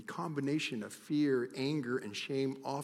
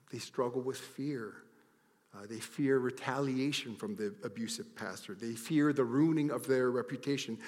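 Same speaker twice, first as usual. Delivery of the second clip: ordinary talk, nothing marked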